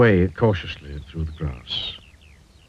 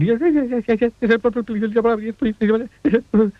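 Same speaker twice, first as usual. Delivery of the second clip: very high, trembling rush of yeses; quivering eagerness; 13 semitones higher than usual. A narrator's reading. Mister Jelly begging for the secret, quivering. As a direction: shake with nervous eagerness. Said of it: "quivered and shook and said".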